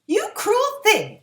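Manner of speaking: slow, high, loud outraged cry; angry cry. A narrator's reading. Girl cries out at the cruel witch, outraged. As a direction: hurl it at the witch. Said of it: "cried Dorothy".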